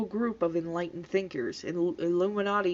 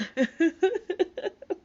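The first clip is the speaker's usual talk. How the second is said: high helpless laughter; cracking up; 11 semitones higher than usual. Real, not acted. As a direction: lose it completely laughing. Cracks up reading comment.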